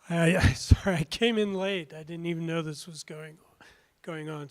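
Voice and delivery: hoarse, losing voice; rough, worn-out voice; raspy whisper. Real, not acted. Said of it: "Sorry, I'm losing my voice".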